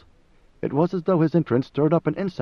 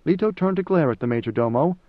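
ordinary delivery, nothing marked

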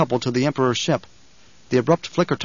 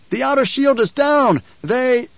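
alarmed shout; 11 semitones higher, 4 dB louder, slower than usual. A narrator's reading. Officer alarm.

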